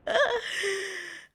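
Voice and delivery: a high, breathy burst of laughter; laughing at her own panic. Real, not acted. Laughing at the Santa prank.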